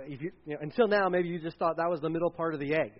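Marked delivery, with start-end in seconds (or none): none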